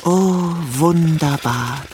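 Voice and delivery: langsam, hoch und verzückt; genießerisch gedehnt ausrufen; entzückte Eidechse. A narrator's reading. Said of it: "rief sie". (die Eidechse genießt das kühle Blatt, langsam und verzückt)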